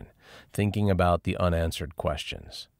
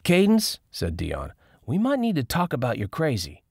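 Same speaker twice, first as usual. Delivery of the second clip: Dion's voice; 8 semitones higher than usual. A narrator's reading. Dion calls out, teasing.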